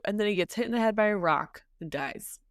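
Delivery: normal talking voice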